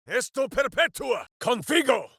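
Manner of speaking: high, booming spell chant; shouted incantation. Acted. Warrior casting a spell mid-fight, shouting the incantation. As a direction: hurl the Latin words like a battle cry.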